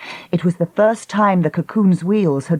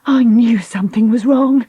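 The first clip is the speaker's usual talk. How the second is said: raised, high cry; 6 semitones higher, 4 dB louder than usual. Acted. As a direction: cry it out in alarm. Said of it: "she cried".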